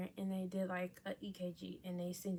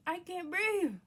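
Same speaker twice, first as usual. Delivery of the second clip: higher, louder desperate cry; high, loud panicked plea; 11 semitones higher, 8 dB louder, slower than usual. Retold or imitated. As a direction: plead for help. Panicked quote to mother.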